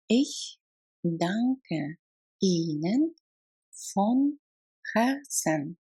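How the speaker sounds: slow, every word on its own. Shown as a demonstration. Teacher models heartfelt thanks for learners, word by word. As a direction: stop briefly after each word. Language drill, broken into words.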